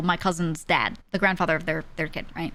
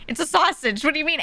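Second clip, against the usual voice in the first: pitched-up imitation of an incredulous husband; baffled protest; 8 semitones higher than usual. Husband answering wife's disgust at a sausage, baffled. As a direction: protest it, mock bewildered.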